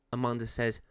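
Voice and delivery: usual speaking voice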